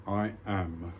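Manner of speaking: slow, measured poem reading with long pauses; poem recitation. Acted. Speaker sharing a poem with viewers, slow solemn recitation. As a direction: declaim each short line, then let it hang.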